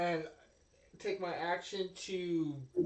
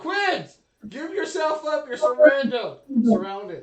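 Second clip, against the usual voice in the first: high, booming stand-off shout; shouted surrender demand; 9 semitones higher, 18 dB louder, slower than usual. Acted. Player demanding the possessed Quids give himself up, shouting. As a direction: yell it like a police stand-off.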